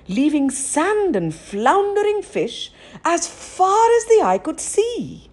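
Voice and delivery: usual speaking voice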